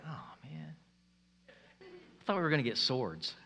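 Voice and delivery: low, soft, let-down grumble; mock deflated. Disciples let down on hearing about servanthood, mock deflated. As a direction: play the disappointed disciple, dropping low and quiet.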